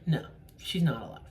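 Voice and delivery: ordinary talk, nothing marked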